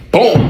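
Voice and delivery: high, sudden "boom"; imitated impact. Storyteller mimics a kick landing, high exclaimed boom. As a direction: punch out a high-pitched boom.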